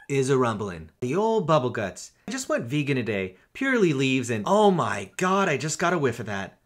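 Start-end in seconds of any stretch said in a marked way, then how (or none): none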